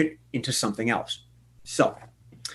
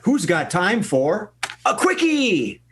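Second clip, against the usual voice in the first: hyped shout; 7 semitones higher, slower than usual. Acted. Host hypes the quickie game.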